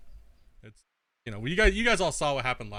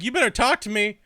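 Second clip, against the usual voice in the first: mock chatter; 6 semitones higher, 5 dB louder than usual. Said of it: "Then he's like".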